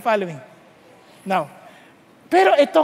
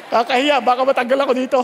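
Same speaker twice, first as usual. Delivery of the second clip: laughing; faster than usual. Real, not acted. Preacher joking to congregation he might get fired, laughing. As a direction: blurt it out while cracking up.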